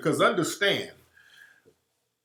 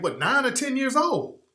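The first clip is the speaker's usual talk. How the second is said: ordinary talk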